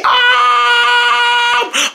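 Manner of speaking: hammed-up crying scream; crying shout. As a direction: wail in protest and panic.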